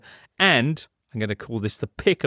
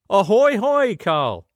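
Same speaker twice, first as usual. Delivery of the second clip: playful ahoy greeting; 8 semitones higher, 4 dB louder, slower than usual. Real, not acted. Jovial greeting of on-line guest.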